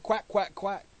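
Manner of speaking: lightly voiced little girl going quack quack; a touch of a toddler's quack; light toddler voice saying quack quack; imitating baby quacking. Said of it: "and she said".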